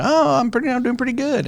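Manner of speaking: bright cheerful answer; cheerful reply. Retold or imitated. Voicing other person's reply.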